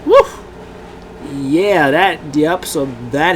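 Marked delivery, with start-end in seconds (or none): 0.0-0.2 cheer woo. Real, not acted. Cheering the title win.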